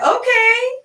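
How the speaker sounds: high, loud squeaky okay; squealed acceptance. Retold or imitated. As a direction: pitch it way up, giddy. Accepting his offer of help.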